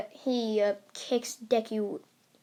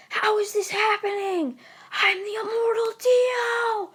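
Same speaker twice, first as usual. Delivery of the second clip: Dio's disbelieving yell; 10 semitones higher, 3 dB louder than usual. Acted. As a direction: cry out in outraged disbelief, pitched way up. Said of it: "as Dio yells".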